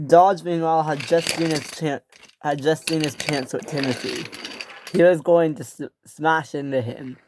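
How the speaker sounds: plain reading voice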